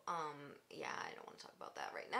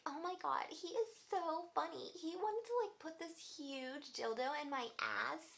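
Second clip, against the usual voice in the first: high, airy mocking girl voice; ditzy valley girl voice; 8 semitones higher than usual. Mocking the girl on the phone, ditzy valley girl impression. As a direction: parody the giggly girl gushing. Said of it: "You literally have to force that voice out".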